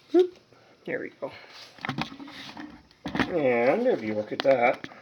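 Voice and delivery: ordinary talk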